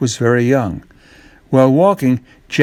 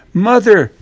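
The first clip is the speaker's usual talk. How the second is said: Jack calls to his mother, raised and high; calling out; 8 semitones higher, 5 dB louder than usual. A narrator's reading. Boy calling his mother in excitement. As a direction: call her from the doorway, excited. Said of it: "calls Jack".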